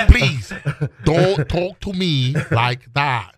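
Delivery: slow, halting kung fu movie delivery; Bruce Lee. Retold or imitated. Schoolboy correcting his teacher, mock Bruce Lee voice. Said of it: "with the Bruce Lee voice".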